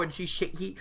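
ordinary talk

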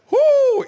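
high shrieking whoop; loud whoop of laughter. Real, not acted.

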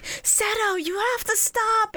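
high pleading little brother; pleading kid. Retold or imitated. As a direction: beg him in a child's high voice. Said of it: "Mokuba just going like".

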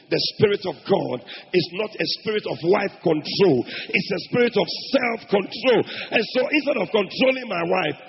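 loud, forceful sermon delivery; full-force preaching. Real, not acted. Preacher urging self-control on husbands, preaching loudly. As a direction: hammer it out to the congregation.

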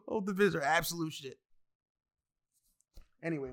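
ordinary delivery

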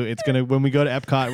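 ordinary talk, nothing marked

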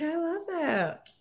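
high, slow, drawn-out gush; mock gushing daughter. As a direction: swoon over it like a smitten kid. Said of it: "She's like".

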